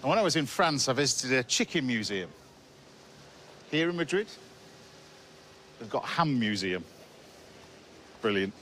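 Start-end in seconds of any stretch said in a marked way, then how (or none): none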